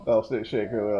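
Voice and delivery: usual speaking voice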